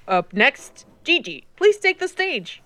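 drama teacher announcing. A narrator's reading. Drama teacher calling the next auditioner up, announcing. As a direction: call the next performer up brightly, pitch lifted.

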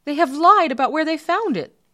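high, loud court doctor's accusation; indignant official, loud and high; accusing court doctor. Acted. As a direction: denounce the liars, pitched right up. Court doctor accuses the two men.

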